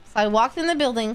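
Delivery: usual speaking voice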